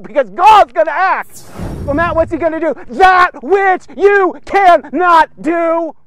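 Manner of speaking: sermon roar; preacher shout. Acted. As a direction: hammer the line home to the crowd.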